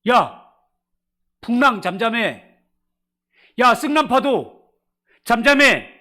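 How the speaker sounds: loud, high shouted command; mock rebuke. Jesus scolding the wind and waves, loud high shout. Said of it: "꾸짖었대".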